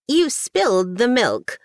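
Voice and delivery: high, sharp scolding woman's voice. Acted. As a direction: accuse him like a stern farm wife. Edna scolds Hans.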